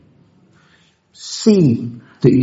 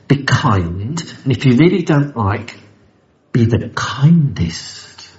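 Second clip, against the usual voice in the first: ordinary talk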